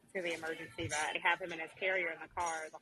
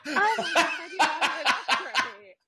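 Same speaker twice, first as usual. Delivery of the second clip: loud, high words through real laughter; laughing hard; 9 semitones higher, 14 dB louder, slower than usual. Real, not acted. Caller telling the vet bill story, cracking up laughing. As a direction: crack up while trying to talk.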